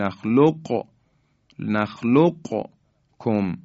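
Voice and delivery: نخلقکم with released q, then separate k; qaf pronounced unmerged. Shown as a demonstration. Tajweed teacher showing the permitted alternative, distinct q in نخلقکم. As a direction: slowly sound the q in نخلقکم before kum. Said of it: "قاف رو تلفظ کنیم و در کاف هم ادغام نکنیم".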